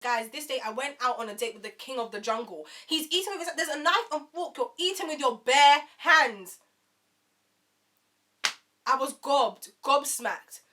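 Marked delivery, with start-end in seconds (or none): 2.9-6.6 higher, louder outraged complaint; indignant disbelief. Retold or imitated. Telling viewers about his table manners, outraged disbelief.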